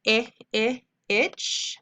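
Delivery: teacher modeling short i; short i sound. Shown as a demonstration. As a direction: isolate the short i, I, then say itch.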